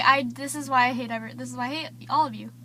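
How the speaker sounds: ordinary talk, nothing marked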